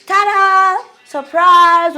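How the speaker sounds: high, loud showy ta-da and surprise; excited ta-da. Retold or imitated. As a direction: announce it like a big reveal to the whole church. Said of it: "he's like".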